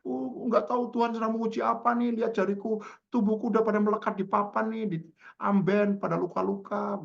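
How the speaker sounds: high, plaintive imitation of a sufferer; lament with full conviction. Sick friend retells suffering face to face, high convinced lament. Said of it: "diceritain lagi dengan penuh keyakinan".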